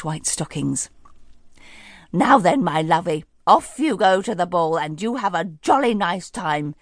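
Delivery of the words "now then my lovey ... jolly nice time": put-on jolly, louder old lady sending her off; beaming fairy godmother. Fairy godmother sends Cinderella to the ball, jolly. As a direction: wave her off merrily. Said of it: "beamed the fairy godmother".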